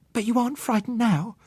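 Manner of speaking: put-on high child voice, hopeful question; a child seeking reassurance; little girl asking. Child Susan voiced in story.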